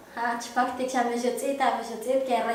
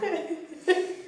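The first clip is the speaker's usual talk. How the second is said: laugh; 5 semitones higher, 5 dB quieter than usual. Real, not acted. Laughs after example.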